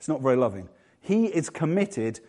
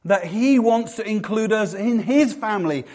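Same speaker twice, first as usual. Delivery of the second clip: usual speaking voice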